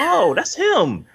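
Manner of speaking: very high, drawn-out realization; retold sudden recognition. Viewer finally placing the actor, amazed. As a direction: stretch it out high as it clicks.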